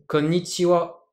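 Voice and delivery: konnichiwa said slowly with careful Japanese pitch accent. Shown as a demonstration. Teacher demonstrates konnichiwa's correct intonation, slow and careful. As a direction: model the word konnichiwa slowly for the class. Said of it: "la bonne intonation".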